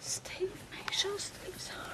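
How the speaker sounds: urgent breathy whisper; anxious whisper. Acted. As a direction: whisper it through the pain. Mother whispers during labour.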